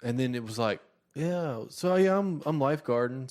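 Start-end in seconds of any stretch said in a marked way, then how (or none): 1.1-3.3 high, halting awkward teen imitation; mock a boy fumbling small talk; pitched-up awkward filler; put-on teen voice. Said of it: "give them the voices that I heard".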